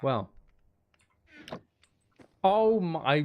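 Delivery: normal talking voice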